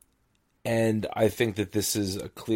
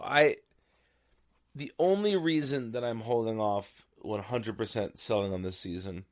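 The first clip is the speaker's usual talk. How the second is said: normal talking voice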